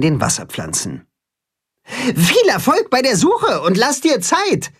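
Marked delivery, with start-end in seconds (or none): 1.9-4.7 hoch hinterhergerufen; nachgerufen. Acted. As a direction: dem Karpfen freundlich nachrufen. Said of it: "ruft Timme ihm nach". (Timme ruft dem Karpfen hoch nach)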